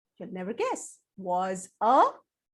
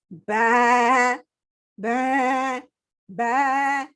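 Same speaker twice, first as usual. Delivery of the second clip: sheep bleat; 5 dB louder, much slower than usual. Acted. Imitates sheep.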